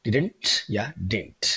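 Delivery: "didn't" said slowly twice with the middle d barely there; light d, slow. Shown as a demonstration. Teacher giving students the model to practise, slow and light d. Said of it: "ऐसे practice करना है".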